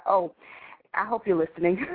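ordinary talk, nothing marked

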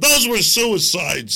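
hammed-up official brushing it off; mock official denial. Mock official to a questioner about the Clintons, slow and loud.

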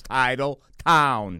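"Title Town" stretched very slow, loud; emphatic enunciation. Shown as a demonstration. Repeating the nickname for the host, slow emphatic enunciation. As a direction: spell out TITLE TOWN with long, booming words. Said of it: "Watch the lips".

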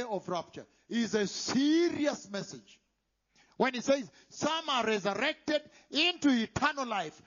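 loud, thundering pulpit warning, slowing on key words; preaching at full force. Acted. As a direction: preach it out with fire and wrath.

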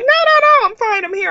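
high, bright polite no no no; sister declining. Retold or imitated. The sister turning down the seat swap, high and breezy. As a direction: brush off the offer breezily.

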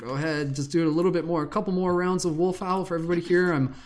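high, loud and quick, cheering the crowd on; excited rallying call. Real, not acted. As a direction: pump up the group to howl. Rousing Zoom crowd to howl.

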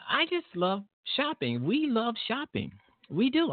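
high, gushing delight. Real, not acted. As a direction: gush about loving it. Gushing about shopping.

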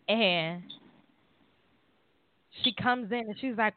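ordinary talk

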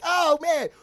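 high, loud cheering like a crowd; imitated crowd cheering at the screen; cheering fan. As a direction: whoop it up like fans in a theater. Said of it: "we all was like".

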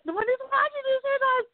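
high, fast, laughing plea; laughing panic. Retold or imitated. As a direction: pretend to hush grandma through tears of laughter. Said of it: "We're like".

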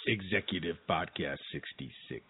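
low, soft announcer imitation; deep announcer voice. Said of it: "I love saying it the way he does it".

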